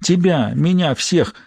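usual speaking voice

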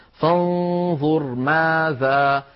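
very slow chanted "فانظر ماذا", stopping after the whole word; وقف على آخر ماذا. Shown as a demonstration. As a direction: draw out "فانظر", keep "ماذا" as one word and stop at its end. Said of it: "فلا يوقف إلا على آخرها". (ترتيل «فانظر ماذا» والوقف على آخرها)

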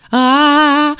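loud, high long scream; demon yelling. A narrator's reading. Horned demon clawing at the shut door, yelling. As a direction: yell out a drawn "aaah". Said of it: "орал рогатый".

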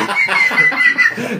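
airy burst of laughter; real snorting laugh; breathy laugh. Real, not acted. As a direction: laugh out loud, unvoiced. GM laughs at player joke.

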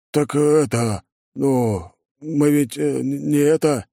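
flustered mumbling. Acted. Foreman fumbling an objection to his lord, flustered and halting. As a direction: stumble over false starts, lost for an answer. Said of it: "замямлил прораб".

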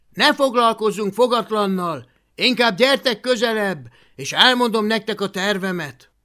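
high, forceful rallying voice; loud. Acted. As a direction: call your friends in loudly. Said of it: "folytatta hangosan".

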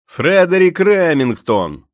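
high, loud and slow, full-throated; shouting at the top of his lungs. A narrator's reading. Stu shouts the painter's name to the sentry at full voice. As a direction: bellow the answer into the storm. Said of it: "на все горло крикнув він".

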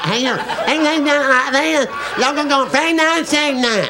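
high Alabama caricature, mouth pulled wide; wide-mouthed Alabama accent. Acted. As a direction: pull your mouth open wide for Alabama. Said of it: "pull their mouth open real wide like this".

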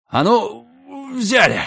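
heave-ho call. A narrator's reading. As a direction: call out the lift. Heave-ho command while lifting. (рабочий окрик)

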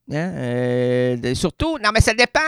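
usual speaking voice

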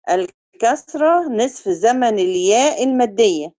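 ordinary talk, nothing marked

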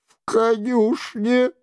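high-pitched little answer; lightly voiced monk, pitched high; meek monk. A narrator's reading.

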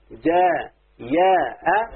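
جاء، يا، أ said piece by piece with gaps; split into parts. Shown as a demonstration. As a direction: sound out جاء، يا، أ one drawn-out part at a time. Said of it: "خد بالك اهو". (نطق جاء، يا، أ مقطّعة جزءًا جزءًا)

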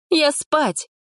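high curt exit line; high sulky snap; huffy girl. A narrator's reading. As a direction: storm off to bed. Polina storms off.

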